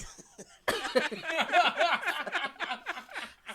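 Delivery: loud high laughter; big laugh. Real, not acted. Laughing after imitating her teasing friends.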